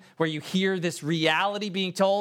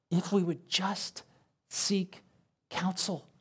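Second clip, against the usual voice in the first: hushed answer; 6 dB quieter, slower than usual. Real, not acted. Preacher answering his own question, softly and slowly. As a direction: drop to a soft, slow hush on seek counsel.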